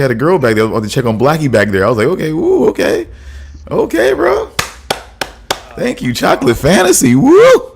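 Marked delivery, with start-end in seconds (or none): 2.1-7.7 high-pitched teasing cheer; playful whooping reaction. Retold or imitated. Teasing co-host about a girlfriend, whooping reaction. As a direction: act out an impressed, high whoop. Acting out his past reaction.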